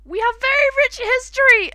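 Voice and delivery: high, loud squeaky creature voice; hammed-up excited Temmie. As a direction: chirp it proudly like Temmie. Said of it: "the tem history was".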